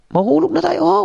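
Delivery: high, frantic plea to the horse; very high terrified cry; panicked cry. Acted. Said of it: "sabi niya sa kabayo".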